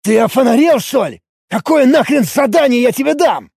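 high, loud outraged yelling; indignant. A narrator's reading. Warrior refusing to give a quest, indignant shouting. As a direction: explode in showy outrage. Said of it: "показное негодование компьютерной программы".

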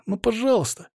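put-on high, pleading kid; plaintive boy. A narrator's reading. Boy begs the reluctant man, high plaintive plea. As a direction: beg in a high boyish voice. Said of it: "жалобно посмотрел на меня мальчишка".

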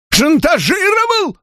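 высокий визгливый вскрик; взвизгнуть от возмущения; визг. A narrator's reading. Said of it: "взвизгнул Дэниел". (Дэниел взвизгивает, услышав обвинение)

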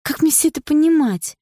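high-pitched upset question; upset girlfriend's high challenge; indignant demand. Acted.

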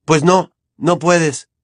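Harry cutting Ginny off; each word bitten off, slow; snapped. Acted. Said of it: "le espetó Harry".

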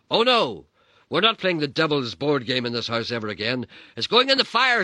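high, loud manic; crazed. Acted. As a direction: declare it half unhinged. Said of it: "she said in a calm voice".

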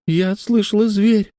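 put-on high, slow child voice; voiced little girl. A narrator's reading. Tearful girl tells Grant she heard a beast, high child voice. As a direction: play a small girl, pitched well up. Лекси в слезах отвечает Гранту.

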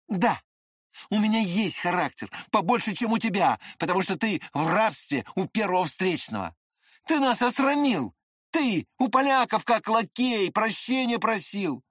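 high, heated accusation; indignant retort. A narrator's reading. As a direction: throw the charge back, rising in pitch. Narrator's hero quarrels with Lambert.

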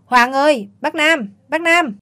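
loud, high call across the house. A narrator's reading. Mother calls her son from inside, loud and high. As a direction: shout the news to the child, drawn out. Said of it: "tôi la lớn lên".

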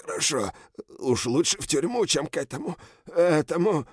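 high, panicked begging; terrified pleading. Acted. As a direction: beg for your life in terror. General panics at the hero.

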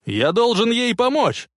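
high-pitched, urgent cry; shouting. A narrator's reading. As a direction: shout with desperate resolve. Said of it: "закричал док". (решительный крик)

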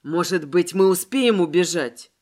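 low-pitched, urgent dread; horrified. A narrator's reading. Воронов hopes they can still run away, horrified. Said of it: "в ужасе проговорил Воронов".

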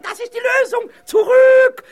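very high, loud cry of discovery; jubilant shout. Acted. Ghost hits on the way out of the wall, jubilant shout. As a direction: shout it in sudden triumph. Ghost suddenly finds the solution.

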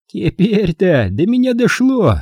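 slow, high-pitched aggrieved exclamation; offended. A narrator's reading. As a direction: draw it out with hurt pride, pitched high. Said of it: "произнёс он обиженным голосом".

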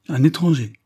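high, loud exclamation of protest; exclaimed protest. A narrator's reading. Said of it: "s'écria monsieur Lidney". (exclamation, voix haute et forte)

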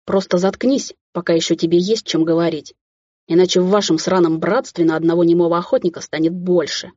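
usual speaking voice

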